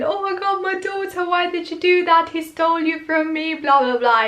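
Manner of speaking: hammed-up distraught mother accusing; mock shocked mother. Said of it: "she's all shocked".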